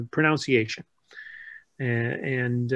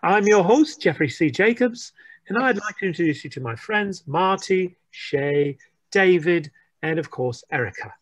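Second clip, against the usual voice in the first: put-on British RP accent; 3 semitones higher, 3 dB louder than usual. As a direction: show host intro in a high-pitched British accent. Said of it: "can you just do the whole episode in the accent".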